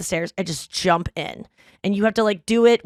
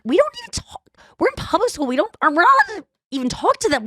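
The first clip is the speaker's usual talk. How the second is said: high-pitched, fast outraged squeal; indignant disbelief; 11 semitones higher, faster than usual. Retold or imitated. Shocked, disbelieving rant.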